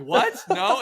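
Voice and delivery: high, loud disbelieving yell; mock outrage at the news; shocked shout. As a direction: soldiers told the guy tried to kill Napoleon, shocked yell. Soldiers shocked at accusation.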